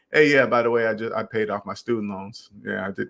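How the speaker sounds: casual throwaway, dropping to a quiet mumble; quietly offhand, barely bothering to announce it; offhand mention. Shown as a demonstration. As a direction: toss it off like it's no big deal. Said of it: "They're not gonna say it like that".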